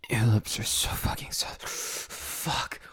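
hushed unvoiced whisper, fading out. Lover whispering praise to partner, soft whisper. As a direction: breathe the words, barely voiced.

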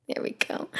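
breathy hushed whisper. Real, not acted. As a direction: whisper it gently. Whispering to baby.

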